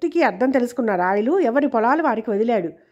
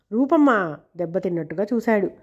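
ordinary delivery

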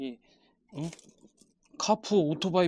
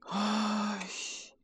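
cursing under breath; 7 semitones higher, 5 dB quieter, much slower than usual. Real, not acted. Missing screw, muttered curse.

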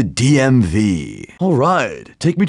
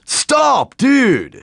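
high alarmed shout; alarmed yell; 13 semitones higher than usual. Acted. Passenger yelling at the reckless driver, high alarmed shout. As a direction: yelp it out as the car goes wrong.

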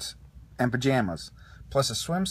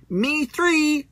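much higher, eager little voice; Rex the dinosaur; 13 semitones higher, 6 dB louder than usual. A narrator's reading. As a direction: squeak it out high and keen. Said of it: "said Rex the dinosaur".

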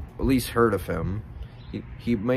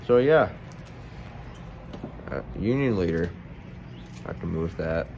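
words through clenched stem; talking around a pipe; 3 semitones lower, 3 dB quieter than usual. Real, not acted. Seen 2: Pipe stem held between teeth through all labelled frames.

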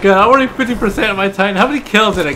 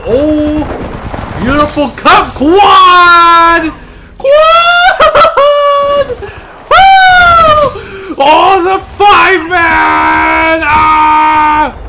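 ecstatic shouting; 10 semitones higher, 4 dB louder, much slower than usual. Real, not acted. Killstreak cheering.

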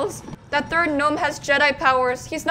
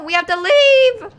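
raised, drawn-out urging; insistent call; 6 semitones higher, 7 dB louder than usual. Real, not acted. Player urging gnomes to leave, insistent loud call. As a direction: call it out high, loud and slow.

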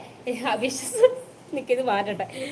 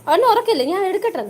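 excited teasing; 5 semitones higher, 6 dB louder than usual. Real, not acted. Teasing husband before surprise gift.

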